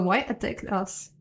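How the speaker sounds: ordinary talk, nothing marked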